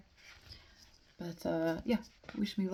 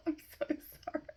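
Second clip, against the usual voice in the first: giggling; 11 semitones higher, much faster than usual. Real, not acted. Reader apologising after mangled German, helplessly giggling. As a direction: barely get it out for giggling. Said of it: "I'd be giggling this much".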